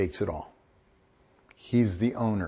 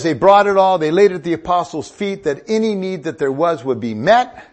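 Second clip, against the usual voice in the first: loud scripture reading; 5 semitones higher, 8 dB louder than usual. Real, not acted. Preacher reading scripture loudly.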